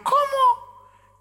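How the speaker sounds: very high falsetto "what?"; comic high-pitched huh; baffled mock query. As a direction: squeak it out as if you can't hear. Acted hard-of-hearing reply.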